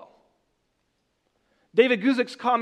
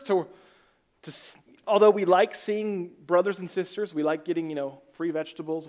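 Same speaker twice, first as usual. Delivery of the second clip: usual speaking voice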